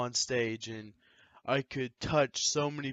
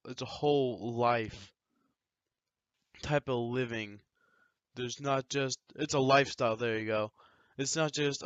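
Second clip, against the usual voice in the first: usual speaking voice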